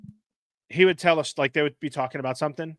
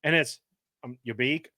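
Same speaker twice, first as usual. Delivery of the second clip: ordinary talk, nothing marked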